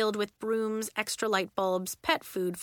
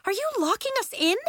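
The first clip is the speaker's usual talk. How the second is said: high, fast, alarmed question; cried, rising; 12 semitones higher, 3 dB louder, faster than usual. A narrator's reading. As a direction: let the voice climb at the end. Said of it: "Nori cried, her voice rising at the end".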